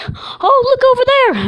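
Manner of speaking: put-on very high, loud childlike voice; little Ethan. Little Ethan pointing something out to him, high childlike call. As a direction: point something out like an excited small kid. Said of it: "little Ethan was like".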